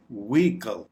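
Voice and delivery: "व्हीकल" eased out without any h; relaxed, silent h. Shown as a demonstration. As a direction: say "व्हीकल" gently, no stress on h. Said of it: "बड़े आराम से इसको".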